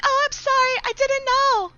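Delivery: very high, loud, fake-sorry voice; mock innocent apology. Student answering the girl she teases, mock innocent.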